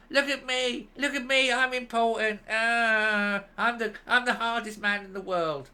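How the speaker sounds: high, far-off mocking squeal of a show-off; mocking impression. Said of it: "And look, there he is".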